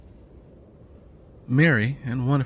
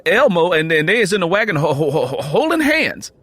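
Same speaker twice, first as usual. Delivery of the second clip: put-on high, loud, fast stuttering boy; excited stammer; 7 semitones higher, 8 dB louder than usual. Boy blurts out news to his father, high and stammering. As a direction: tumble over the news in a rush.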